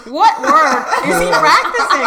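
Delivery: retold exasperated question, drawn out; baffled protest. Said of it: "I was like".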